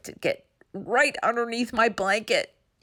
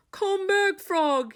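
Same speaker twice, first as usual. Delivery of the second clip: pleading shout; 13 semitones higher, slower than usual. A narrator's reading. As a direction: beg him to return. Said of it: "he shouted".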